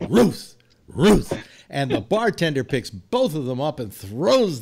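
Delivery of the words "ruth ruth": two high, loud barks of Ruth; talking dog bark. Acted. Dog names baseball's greatest in a joke, barking. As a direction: woof out the name like a dog. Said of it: "And the dog goes".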